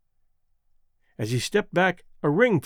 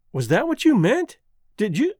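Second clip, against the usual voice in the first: surprised; 6 semitones higher, 3 dB louder than usual. Acted. Model realizes his meaning.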